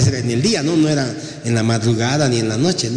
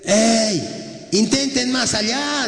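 calling out from the shore; 7 semitones higher, much slower than usual. Acted. Lord calls out from shore.

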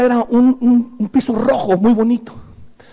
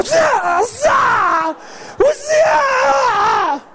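high, drawn-out mock martial-arts yells; hammed-up ninja mom striking, pitched way up; ninja attack cries; 12 semitones higher, much slower than usual. Mom swings the hose like a ninja, high battle cries. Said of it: "era como una ninja".

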